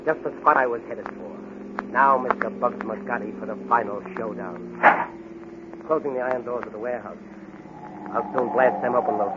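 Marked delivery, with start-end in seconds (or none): none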